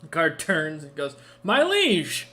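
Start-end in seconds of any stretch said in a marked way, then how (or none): 1.4-2.3 high, loud guard's bellow; booming announcement to the ruler; top-of-lungs shout. A narrator's reading. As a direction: shout it out to fill the throne room. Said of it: "He shouts at the top of his lungs".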